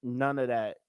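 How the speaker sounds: unbothered calm voice, low and soft; calm woman. Retold or imitated. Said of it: "And she's like".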